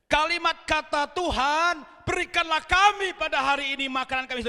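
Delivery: full-force preaching. Acted. Pastor preaching to the congregation not to worry, loud and emphatic. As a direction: drive the point home to the church.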